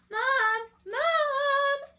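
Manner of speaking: put-on high, loud child calling out for Mom; little girl yelling for mom across the house; excited little girl calling. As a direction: shout for Mom like an eager little bear girl. Lucy calls her mother from bed.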